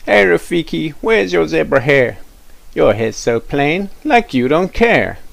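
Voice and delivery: high, loud schoolyard taunt; taunting kid. Acted. Cool kid mocking Rafiki, high jeering taunt. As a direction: tease him in a sing-song jeer.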